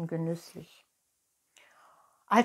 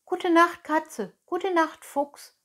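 put-on high-pitched little puppet boy; polite Pinocchio; 9 semitones higher, 6 dB louder than usual. A narrator's reading. Pinocchio bids cat and fox good night, high and polite. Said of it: "grüßte Pinocchio höflich".

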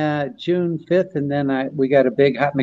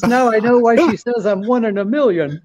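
higher, laughing into the punchline; Rodney punchline laughing; 7 semitones higher than usual. Retold or imitated. Punchline of Rodney's joke told to friends, higher and laughing. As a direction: crack up on the last line. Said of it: "He goes".

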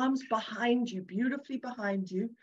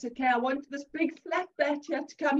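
ordinary talk, nothing marked

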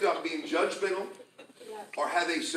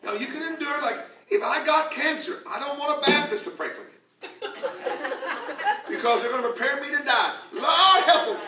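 higher, louder revival preaching; full force preaching; 8 semitones higher, 5 dB louder than usual. Acted. Pastor fires up the congregation, preaching louder and higher.

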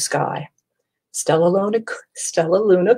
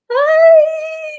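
piercing high loud shriek; 7 dB louder than usual. A narrator's reading. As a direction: shriek in terror. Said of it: "shrieked Flap".

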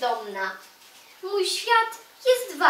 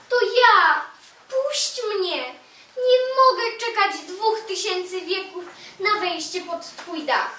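calling out; 6 semitones higher, 4 dB louder than usual. Acted. Knocking, begging the stone to open.

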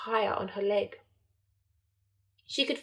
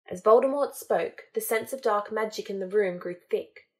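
plain reading voice

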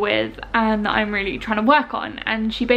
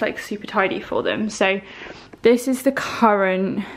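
ordinary talk

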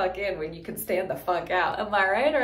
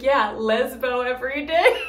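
louder laughing quote; 3 semitones higher, 6 dB louder than usual. Retold or imitated. Quoting Victor's joke, laughing. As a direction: laugh through the line. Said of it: "Victor's like".

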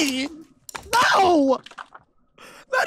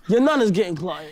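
put-on London accent; 2 semitones lower than usual. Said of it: "in that accent".

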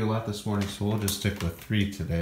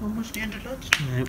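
high pet talk; 11 semitones higher than usual. Real, not acted. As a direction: coo it up high to the kitty. Cooing to cat, much higher.

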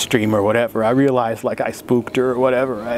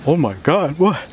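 stunned; 4 semitones higher than usual. Retold or imitated. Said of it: "the old me would have been, like, stunned".

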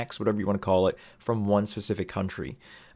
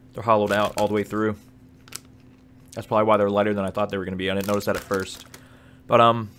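speaking between bites; chewing while talking; 4 dB louder, faster than usual. Real, not acted. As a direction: mouth partly full of snack. Seen 2: Pops small snack in at 0.2, then jaw working while speaking downward.

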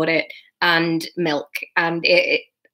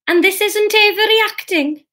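nervous question, pitched much higher; 13 semitones higher, 4 dB louder than usual. A narrator's reading. Mother nervously asking Jesus if they're overreacting. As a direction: ask it anxiously, voice jumping up. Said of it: "asked Catherine nervously".

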